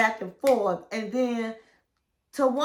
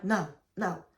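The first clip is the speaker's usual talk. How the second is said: meek mumble; 5 semitones lower, 7 dB quieter than usual. Retold or imitated. Tony's meek answer, retold.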